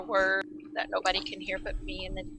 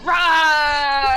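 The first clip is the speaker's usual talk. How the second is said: shout; 7 semitones higher, 9 dB louder than usual. Retold or imitated. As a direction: yell it loud and urgent. Player bursts in yelling.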